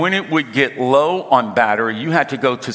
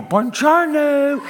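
put-on much higher, slow, sing-song Italian accent on "Buongiorno"; mock Italian accent; 11 semitones higher, slower than usual. Husband teasing his wife's Italian greeting, high Italian accent. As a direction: imitate the wife's proud Italian accent with a laugh. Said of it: "Had an amazing Southern Italian accent".

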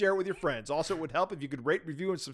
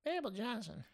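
put-on old lady voice; 4 semitones higher, 13 dB quieter than usual. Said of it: "old lady voice I do".

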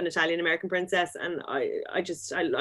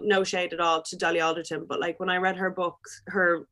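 normal talking voice

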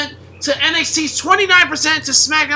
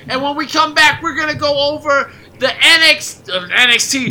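ordinary delivery

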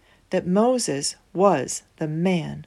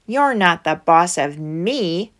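defiant quote; 5 dB louder than usual. Acted. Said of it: "some of the people thought".

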